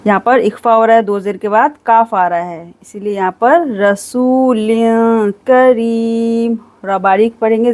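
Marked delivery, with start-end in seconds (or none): none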